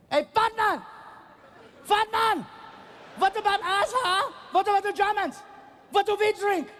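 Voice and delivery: high put-on German accent shouting; mock German heckler yelling. Tall German heckler at the stage, mock yelling. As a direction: bark at the comedian like a giant drunk. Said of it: "he's yelling".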